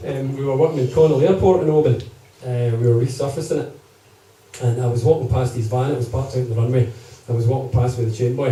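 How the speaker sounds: normal talking voice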